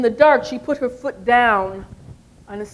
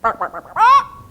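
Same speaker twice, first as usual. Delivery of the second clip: clucking like a hen; chicken clucking; 13 semitones higher, 4 dB louder than usual. Acted. Chicken brushing off the mouse with clucks. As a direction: cluck dismissively. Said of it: "And the chicken said".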